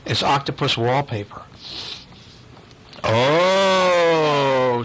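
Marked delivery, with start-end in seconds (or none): 3.0-4.8 mice's oh. Pretend. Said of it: "say the mice".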